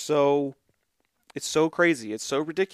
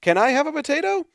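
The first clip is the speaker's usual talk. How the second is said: put-on higher, quicker pleading children; eager kids; 11 semitones higher, faster than usual. Girls at the door begging for a potato, high and quick. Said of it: "the others screamed".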